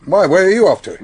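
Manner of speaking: sudden high, loud question; surprised. Acted. Inspector to Fell, startled he is heading home. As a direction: caught off guard by him leaving.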